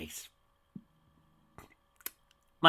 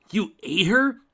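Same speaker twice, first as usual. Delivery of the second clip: high, much louder horrified question; shocked outburst; 11 semitones higher, 11 dB louder, slower than usual. A narrator's reading. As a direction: blurt it in horror. Dragon horrified at the villain.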